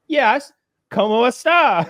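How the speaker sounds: high, loud mock bad Spanish accent; badly faked Spanish. Saleswoman pretending she speaks Spanish, badly faked accent. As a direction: butcher the Spanish in a high, mocking voice. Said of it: "Regular Peggy Hill".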